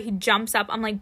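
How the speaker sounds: ordinary delivery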